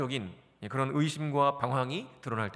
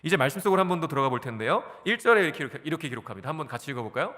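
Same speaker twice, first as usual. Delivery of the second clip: usual speaking voice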